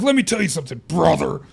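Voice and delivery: a half-hearted Hogan promo line; mock Hulk Hogan. Cohost riffs on the gimmick with a mild wrestler growl. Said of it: "every time you said brother ... more distorted".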